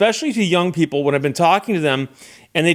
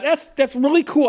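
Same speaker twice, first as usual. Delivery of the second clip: excited thought; 10 semitones higher than usual. Retold or imitated. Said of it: "and I thought".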